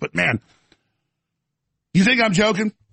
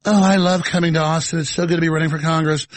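mock prim voice; 2 semitones lower, faster than usual.

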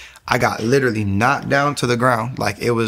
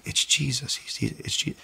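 softer, whispered realization; awed whisper; 10 dB quieter than usual. Retold or imitated. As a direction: breathe the name in quiet awe. Quiet realization of faith.

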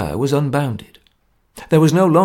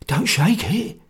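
hoarse whisper; 6 semitones higher, slower than usual. Acted. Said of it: "he whispered hoarsely".

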